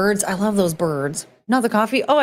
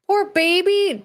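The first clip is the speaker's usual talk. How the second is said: pitying coo; 11 semitones higher, slower than usual. Real, not acted. Streamer pitying the epileptic dog, high coo. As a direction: coo it with pity, much higher.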